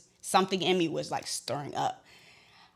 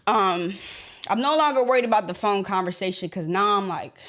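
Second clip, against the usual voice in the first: usual speaking voice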